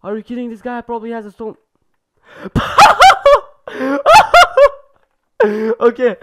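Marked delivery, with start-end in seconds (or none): 2.3-4.8 helpless loud laughter at a noob; explosive laugh. Real, not acted. Gamer cracking up at an opponent, loud laughing fit. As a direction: burst out in a loud, high cackle. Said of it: "I just laughed so hard".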